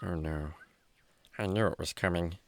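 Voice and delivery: low, soft dread; dismayed. Acted. Housemate hears something happened with Parker, low and dismayed. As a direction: sink into quiet dismay. Listener dreading the bad turn.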